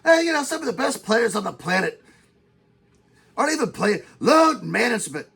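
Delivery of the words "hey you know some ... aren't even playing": usual speaking voice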